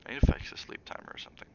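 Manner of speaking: a real whisper off the mic, quick and private; whispered aside. Real, not acted. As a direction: breathe it out as a hurried aside. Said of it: "whispered aside off the reading".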